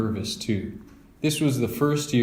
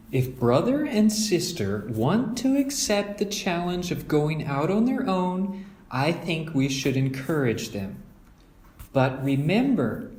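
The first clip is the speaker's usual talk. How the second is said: higher, encouraging mother voice; Mama; 7 semitones higher than usual. Acted. Mother persuading father to let the cubs go, encouraging. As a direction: back the cubs up warmly.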